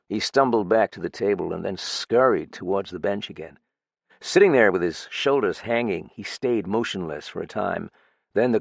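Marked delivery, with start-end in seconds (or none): none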